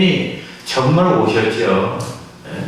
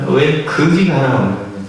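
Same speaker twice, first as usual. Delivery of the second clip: usual speaking voice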